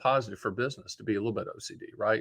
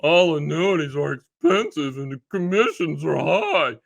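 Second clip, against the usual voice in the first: slow, drawn-out mock whine; mocking complainer; 4 dB louder than usual. Quoting people who complain about fees, mockingly. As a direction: parody a griper, stretching the words out. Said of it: "So when people say".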